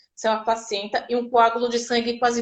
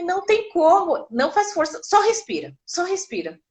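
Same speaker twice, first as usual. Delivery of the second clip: much higher, strained plea; desperate protest; 7 semitones higher than usual. Retold or imitated. Mother in labor protests she can't stop pushing, higher and strained.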